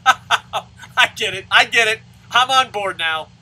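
loud, high mock enthusiasm after a laugh; sarcastic agreement. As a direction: pretend to be convinced, shouting it.

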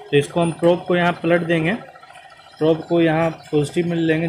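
usual speaking voice

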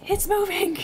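alarmed cry. Acted. Panic at a moving thing.

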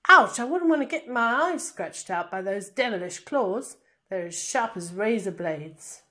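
flinch aloud like a presenter near claws; mock-alarmed presenter. Shown as a demonstration. Teacher performs her commentary, mock alarm at claws.

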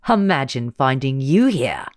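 loud mocking gloat. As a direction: taunt her with glee. Villain gloats at Lena.

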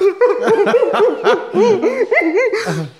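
high, loud mock sobbing; hammed-up wailing imitation of crying; imitated bawling. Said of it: "I was just crying".

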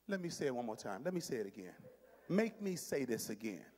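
very low, quiet, measured warning; low, slowing grandmama threat; stern grandmama. Acted. Grandmama daring a child to make her repeat, stern warning. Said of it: "This is one my grandmama liked".

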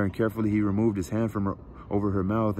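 ordinary delivery

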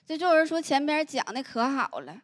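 ordinary talk

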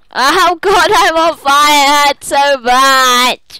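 high, loud, drawn-out wailing; panicked game scream. Pretend. Player burning in the game, mock-agonized scream. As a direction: howl in mock agony. Catches fire in the game again.

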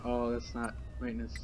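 ordinary talk

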